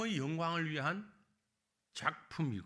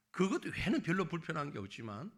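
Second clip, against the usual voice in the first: usual speaking voice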